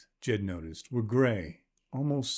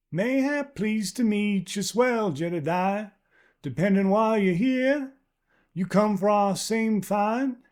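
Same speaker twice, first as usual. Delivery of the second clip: much higher and louder, a well-used voice; worn old woman calling back; 10 semitones higher, 4 dB louder than usual. Acted. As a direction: call back from afar like a tough pioneer wife.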